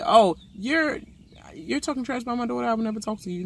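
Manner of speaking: lightly voiced mocking castmate. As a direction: parody her excuse in a prissy voice.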